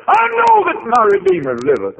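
high, loud ringing proclamation; preaching at full force. Acted. Preacher voicing Job's vow to the congregation, ringing out loud. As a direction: fling the vow to the rafters.

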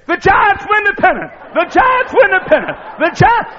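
high, loud ecstatic radio call; announcer screaming the winning call. Retold or imitated. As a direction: scream it like the famous home-run call. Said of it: "started saying".

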